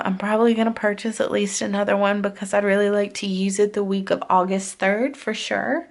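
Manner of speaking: usual speaking voice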